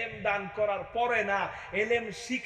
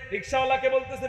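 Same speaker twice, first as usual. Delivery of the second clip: ordinary talk, nothing marked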